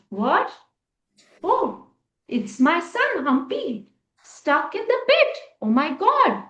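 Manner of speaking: stunned to find his son; father discovering son in pit; shocked father. A narrator's reading.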